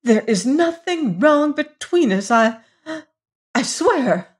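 high, halting protest; defensive stammer. A narrator's reading. Lady defending herself to Hagar, high faltering protest. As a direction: plead innocence, pitched well up. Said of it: "drawn into defending herself".

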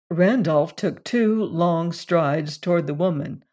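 ordinary delivery, nothing marked

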